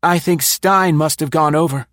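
put-on high, tense voice of a paranoid old spy; nervous conspirator pitched high; Densham's voice. A narrator's reading. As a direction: voice the frightened man up high. Narrator voices Densham, frightened.

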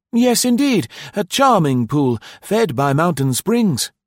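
high, loud, cheerful innkeeper; eager host. A narrator's reading. Host assures arriving guest there is a vacancy, eagerly.

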